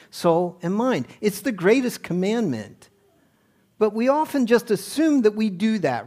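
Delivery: fervent sermon delivery; preaching. Acted. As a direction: drive it home from the pulpit. Preacher builds to full force.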